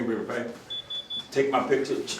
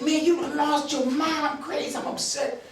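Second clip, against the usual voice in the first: high, hot disbelief falling to a breathy hiss; worked-up retort to the officer; exasperated outburst; 8 semitones higher than usual. Retold or imitated. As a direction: tell him he's lost his mind, then seethe under your breath. Arguing with the officer.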